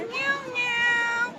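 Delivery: high, long drawn-out meows; soft, playful kitty imitation; cat meowing. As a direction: meow sweetly up high for a child.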